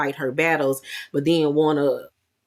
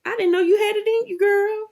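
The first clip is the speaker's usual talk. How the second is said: very high, fast delighted squeal. Real, not acted.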